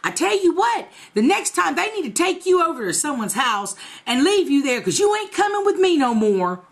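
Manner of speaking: high mock scolding; high imitated scolding; mock scolding grandma. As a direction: imitate the grandma threatening the boy. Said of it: "I heard her say".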